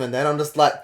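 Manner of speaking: normal talking voice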